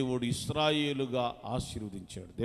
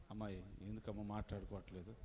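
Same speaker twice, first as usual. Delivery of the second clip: gentle asking; 7 semitones lower, 11 dB quieter, faster than usual. Retold or imitated. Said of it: "మనం అనమండి".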